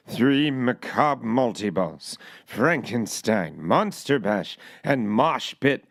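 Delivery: deep, slow Orson Welles voice; low and slow, grand old radio voice. Retold or imitated. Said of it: "I'll do it like Orson Welles".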